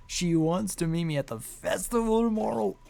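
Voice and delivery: triumphant lovestruck glee; elated character. Acted. Character reads note elated.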